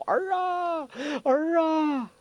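very high, drawn-out wail; anguished wail. Acted. Yinggu crying for her son, anguished wail. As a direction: cry out long and high for a lost child. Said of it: "不住的惨叫".

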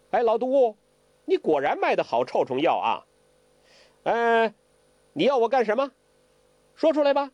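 lightly voiced old prankster, pitched high; high, teasing challenge; Zhou Botong. Zhou Botong demands the old poisoner speak up, high-pitched challenge.

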